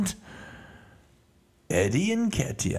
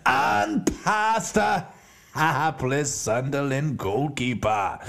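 ordinary talk

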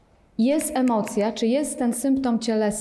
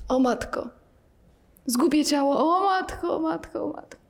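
mock dismay; 5 semitones higher than usual. Teacher acts out the frightened mind, fading mock moan. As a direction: whimper it out as the panic sinks. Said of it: "to mówimy".

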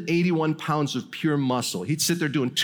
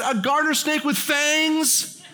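laughing disbelief; 10 semitones higher, slower than usual. Retold or imitated. Narrator mocks Dan's mistake.

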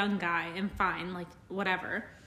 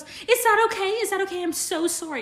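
dramatic apology; 8 semitones higher than usual. Retold or imitated. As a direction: plead over and over like a panicked worker. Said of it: "It's so dramatic".

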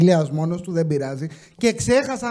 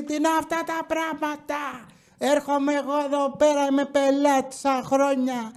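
high, put-on indignant lady voice; mock the offended customer; imitating angry customer; 5 semitones higher, 4 dB quieter than usual. Customer ranting to the cashier, high but soft mock voice.

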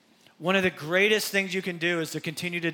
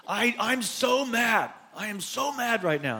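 mock mad kid; 5 semitones higher than usual. Child insists to dad, hammed-up mock anger. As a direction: stretch it out like a sulking kid. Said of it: "our kids would often say to us".

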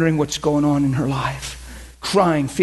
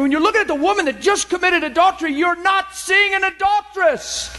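high impassioned preaching; preaching at full force; 11 semitones higher than usual. Acted. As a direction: drive the point home to the congregation.

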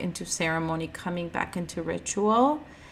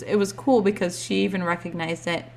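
usual speaking voice

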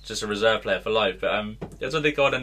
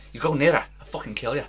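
mock threat in a Scouse voice; attempted Scouse accent; 4 semitones higher, faster than usual. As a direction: put on a menacing Scouse accent. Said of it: "Why is he Scouse now?".

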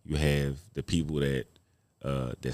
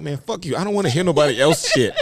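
higher, louder fed-up snap; mock angry retort; 10 semitones higher, 7 dB louder than usual. Comic after Apollo boos, fed up with motivational friends, mock angry. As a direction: brush off the pep talk. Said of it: "I'm like".

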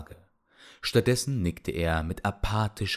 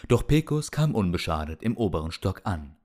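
plain reading voice

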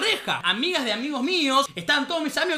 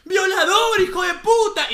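raised, loud mock insults; angry yelling; 5 semitones higher, 5 dB louder, slower than usual. Girl insulting guard from outside, high mock-angry shout. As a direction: shout abuse in imitated outrage. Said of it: "empezaron a gritarle".

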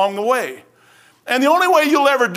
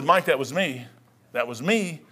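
God's quiet voice; 6 semitones lower, 7 dB quieter than usual. Retold or imitated. Voicing God speaking to him.

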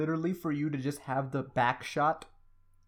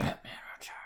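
whispered bat impression; 7 dB quieter, faster than usual. Shown as a demonstration. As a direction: breathe it out like a bat. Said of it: "How's my Bat impression been?".

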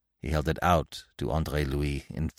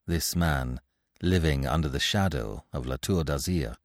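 ordinary delivery

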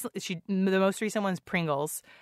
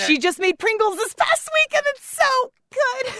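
excited; 7 dB louder than usual. Real, not acted. Gushing over the show.

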